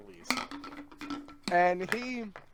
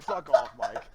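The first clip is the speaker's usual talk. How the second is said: retold snap back; 4 semitones lower, 7 dB louder, slower than usual. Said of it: "I'm like".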